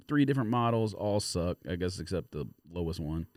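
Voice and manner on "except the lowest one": ordinary delivery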